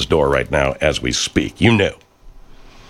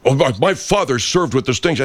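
higher, whiny mock of the troll; 7 semitones higher than usual. Mocking the troll to listeners: higher whiny mock voice. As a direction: lampoon the pompous troll, pitched up.

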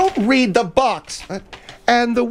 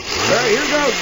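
normal talking voice

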